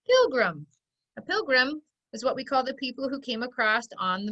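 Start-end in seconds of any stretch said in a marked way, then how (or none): 0.1-0.6 said fast. Shown as a demonstration. Teacher telling pupils to say it fast, PILGRIM said quickly. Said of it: "Say it fast".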